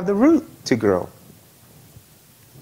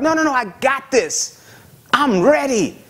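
higher, cocky know-it-all; 8 semitones higher than usual. Retold or imitated. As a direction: brush off the pastor, pleased with yourself. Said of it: "And they're like".

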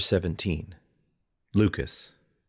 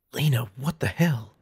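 higher, sharp angry protest; angry hiss; 6 semitones higher than usual. A narrator's reading. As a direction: hiss it in annoyed disbelief. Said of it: "I hissed".

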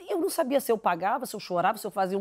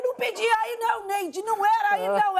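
mock protest; 9 semitones higher than usual. Shouting at Neide.

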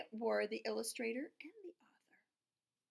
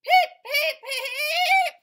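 very high, loud, slow laughing peeps; giggly duckling peeps; 16 dB louder, much slower than usual. Acted. Reading to a child, duckling peeping with laughter. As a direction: peep through giggles. Baby duck carried outside.

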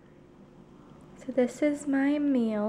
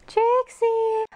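much higher, louder call; excited call; 12 semitones higher, 8 dB louder than usual. Real, not acted. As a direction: gasp then high loud name. Calling the dog excitedly.

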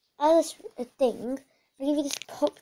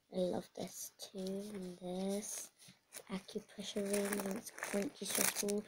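quiet gloating; 5 semitones lower, 12 dB quieter than usual. Acted. Gloating over new fidgets quietly.

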